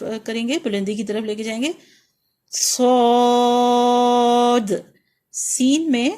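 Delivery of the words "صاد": صاد slow and loud, thick hiss, long aa; heavy hissed letter. Shown as a demonstration. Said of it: "صاد میں موٹی سیٹی ہوتی ہے".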